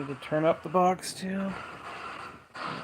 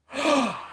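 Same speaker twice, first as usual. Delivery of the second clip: startled high gasp; a sudden frightened intake of breath; sharp gasp; 9 semitones higher than usual. Real, not acted. Hears a voice in the room.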